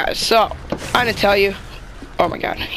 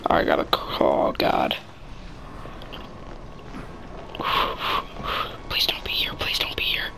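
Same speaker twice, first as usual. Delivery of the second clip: frightened pleading whisper; scared whisper; 8 dB quieter than usual. Real, not acted. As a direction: beg under your breath. Praying to not be caught.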